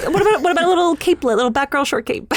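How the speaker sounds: high, fast wheedling plea; mock pleading. High fast wheedling plea for a tiny cape. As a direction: coax them into a tiny cape.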